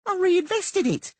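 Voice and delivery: high, slow, proud; smugly pleased with himself; proudly. A narrator's reading. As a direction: preen as you say it. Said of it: "Teleko said proudly".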